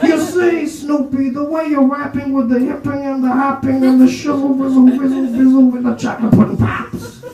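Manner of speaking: Cosby babbling about hip hop; Bill Cosby impression. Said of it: "conversation between Bill Cosby and [name]".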